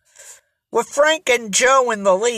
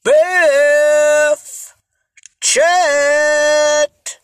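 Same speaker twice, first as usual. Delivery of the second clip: long-held calls, higher and louder; hallooing shout; 6 semitones higher, 4 dB louder, much slower than usual. A narrator's reading. Joe calls for missing friends across the island, shouting. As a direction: call out for someone far away, stretching each vowel. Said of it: "cupped his hands and shouted".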